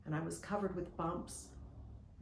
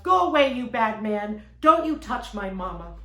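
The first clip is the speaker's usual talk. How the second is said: put-on very loud, indignant small-child yell; loud, punchy outburst at an intruder; 3 semitones higher, 13 dB louder than usual. A narrator's reading. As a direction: shout it like a protective toddler. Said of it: "Stevie yelled".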